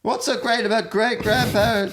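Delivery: high-pitched mock stand-up delivery; exaggerated comic set-up in a high voice; hammed-up joke. Hamming up a co-host's old joke.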